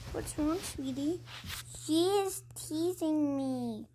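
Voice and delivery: usual speaking voice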